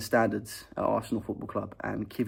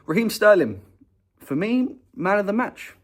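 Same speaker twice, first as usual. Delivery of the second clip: excited announcement; 10 semitones higher, 7 dB louder than usual. Real, not acted. Announcing his man of the match.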